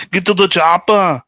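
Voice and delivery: high, loud Austrian action-hero bark; hammed-up Austrian muscleman command; Arnold Schwarzenegger impression. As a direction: shout it like an Austrian action star. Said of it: "like Arnold Schwarzenegger".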